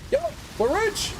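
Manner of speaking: high, rising delighted cry; thrilled about the loot drop; excited squeal. Real, not acted. Gamer finding coin of power, high excited cry.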